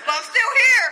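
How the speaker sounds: high, laughing pride; proud laughing joy; joyful celebration. Real, not acted. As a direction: announce the clean date proudly. Celebrates clean date.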